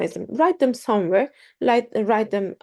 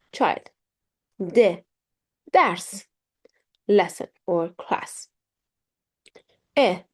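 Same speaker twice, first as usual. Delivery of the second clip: normal talking voice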